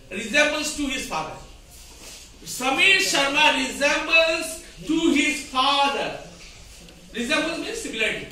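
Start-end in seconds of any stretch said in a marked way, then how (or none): 2.4-6.2 slow, deliberate reading of the faulty sentence; slow dictation. Shown as a demonstration. Teacher slowly reads the wrong example to students. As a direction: spell it out slowly for the class. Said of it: "the sentence is wrong".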